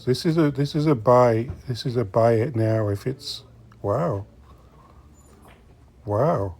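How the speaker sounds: ordinary delivery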